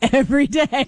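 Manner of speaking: said through a laugh; amused, laughing words. Real, not acted. Laughing at own boast being believed.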